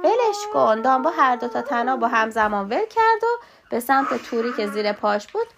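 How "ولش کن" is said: mouse yelling. Acted. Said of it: "موش دوباره داد، تکرار کرد".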